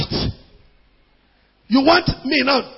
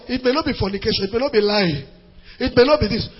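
ordinary talk

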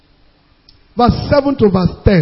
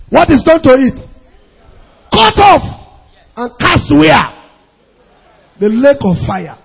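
raised voice with high, punched bursts; forceful preaching; 4 semitones higher, 4 dB louder than usual. Real, not acted. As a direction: drive the judgement home to the congregation.

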